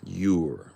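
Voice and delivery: "You're" spoken alone as "yoo-er"; contraction "you're". Shown as a demonstration. Repeating the right word, "You're" sounded out as "yoo-er". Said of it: "you say".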